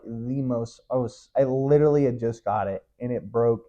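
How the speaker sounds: usual speaking voice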